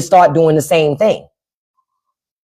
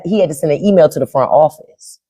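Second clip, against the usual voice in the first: usual speaking voice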